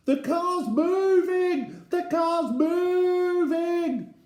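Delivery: put-on high, long-drawn child's scream. A narrator's reading. Eddie in the rolling car, high screaming. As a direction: scream it slowly, stretching every word. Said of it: "He is screaming".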